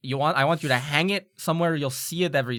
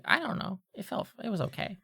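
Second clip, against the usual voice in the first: small, soft, higher-pitched voice; Veldin, meek; 6 semitones higher, 6 dB quieter than usual. Acted. Student pushed into the middle of an apology, meekly downplaying it. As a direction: shrug it off timidly and quietly.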